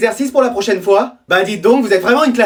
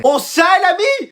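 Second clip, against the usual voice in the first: mock friendly call; 9 semitones higher, slower than usual. Friend answers in odd voice.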